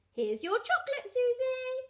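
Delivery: high, loud piglet voice; little Peppa. A narrator's reading. Peppa gives Susie her chocolate, high piglet voice. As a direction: present it in a high child's voice.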